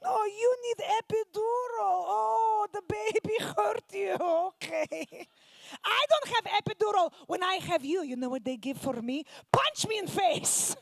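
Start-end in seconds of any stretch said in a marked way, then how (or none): 0.0-8.0 higher, louder Russian scorn; Russian accent criticizing; mocking Russian mom. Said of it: "my mom's in the corner criticizing". 9.5-10.8 slow, higher Russian deadpan boast; Russian accent boast; Russian mom. Retold or imitated. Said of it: "my mom's criticizing".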